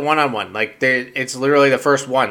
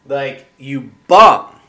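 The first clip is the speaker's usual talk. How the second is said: usual speaking voice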